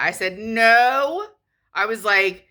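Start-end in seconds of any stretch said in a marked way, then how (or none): 0.4-1.3 a loud, higher, final no; firm no. Retold or imitated. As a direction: slam the refusal down. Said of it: "I said that".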